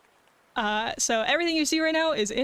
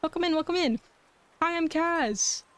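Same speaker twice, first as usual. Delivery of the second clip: cute anime streamer; 6 semitones higher than usual. Real, not acted. Greeting raiders.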